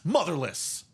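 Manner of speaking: hammed-up sports heckle; mock heckle. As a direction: call out a pretend insult at a player. Mock insult after dropped pass.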